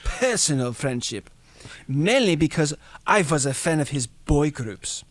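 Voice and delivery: the German man playing down the friendship; a hammed-up German accent; terrible German accent. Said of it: "It's my terrible German accent".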